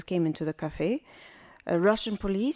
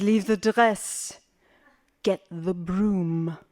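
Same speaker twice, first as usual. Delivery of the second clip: slow, deliberate order; commanding mother; much slower than usual. Acted. Mother squeezing her daughter's arm, slow command. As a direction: give each word slow, deliberate weight.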